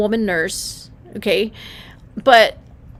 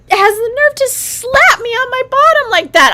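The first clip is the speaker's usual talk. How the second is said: high, loud outrage at the doctor; indignant; 13 semitones higher, 7 dB louder than usual. Retold or imitated. Telling how the doctor dared to slap her, high and indignant. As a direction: spit out the insult of it. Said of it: "really upset".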